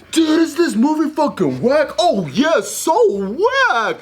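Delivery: squeaky excited stoner voice, very high; high-pitched stoner voice. Retold or imitated. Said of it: "you're like".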